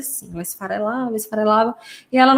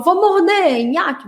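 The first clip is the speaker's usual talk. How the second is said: mordida de brincadeira; 7 semitones higher, slower than usual. Shown as a demonstration. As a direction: announce the bite playfully to a child, pitched up. Demonstra brincadeira de morder. (aviso brincalhão de mordida, em tom agudo)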